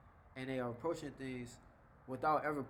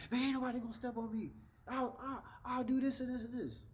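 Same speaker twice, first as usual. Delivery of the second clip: high-pitched, worked-up tough talk; mock someone blustering that nobody crosses them; aggressive threat; 9 semitones higher than usual. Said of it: "trying to get a point across aggressively".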